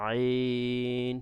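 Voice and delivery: আইন stretched long from inside the throat; throaty ain. Shown as a demonstration. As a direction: pull আইন up from the throat and hold it. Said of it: "গলার ভিতর থেকে আনবেন". (গলার ভিতর থেকে টেনে আইন)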